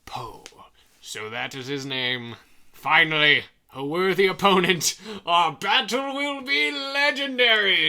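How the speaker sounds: building from measured to a loud, high roar; grandly declaim, swelling to a drawn-out battle cry; villain impression. Tai Lung greeting a worthy opponent, hammed-up rising shout. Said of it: "My favorite one is".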